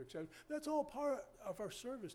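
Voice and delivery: usual speaking voice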